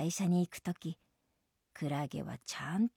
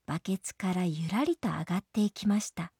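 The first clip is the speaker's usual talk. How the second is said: ordinary delivery, nothing marked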